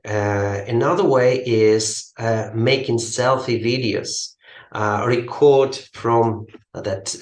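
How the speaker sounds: ordinary delivery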